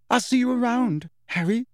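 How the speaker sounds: high, dramatic farewell. A narrator's reading. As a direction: deliver it with theatrical flair. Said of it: "she said dramatically".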